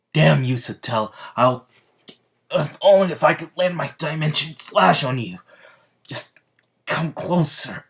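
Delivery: high, loud, strained cursing at the witch; furious curse. Acted.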